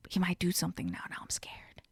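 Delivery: whispered, getting fainter; imitating a frightened whisper; mock scared whisper. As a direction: whisper it like you fear he'll hear. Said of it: "she even had that face like".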